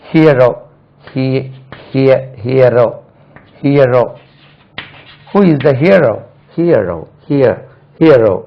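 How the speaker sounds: hero built up from "here": "HEE-uh-ro"; syllable drill. Shown as a demonstration. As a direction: drill hero in pieces with gaps, glide after he. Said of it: "தமிழ்ல பாருங்க".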